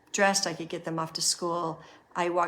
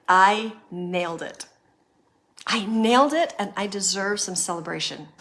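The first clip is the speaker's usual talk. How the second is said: louder, proud inner voice claiming the win; proud declaration; 7 dB louder than usual. Pretend. Coach voicing a listener's proud thought, louder and triumphant. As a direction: own the victory out loud.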